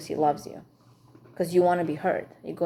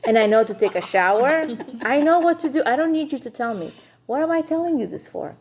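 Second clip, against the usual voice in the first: higher and louder, fed-up mock rant; exasperated wife; 5 semitones higher, 4 dB louder than usual. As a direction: throw it back at him, rising in exasperation. Wife exasperated by husband's advice.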